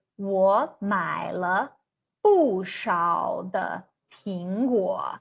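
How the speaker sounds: slow, clear Mandarin read for learners; careful Chinese example. Shown as a demonstration. Teacher modelling the moved le for learners. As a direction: say "我买了不少的苹果" slowly, tone by tone. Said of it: "you would say".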